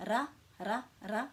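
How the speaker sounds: "Ra" repeated with a small roll on the R; lightly rolled R. Shown as a demonstration. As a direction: roll the R a little on each short "Ra". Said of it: "rolling your R a little bit".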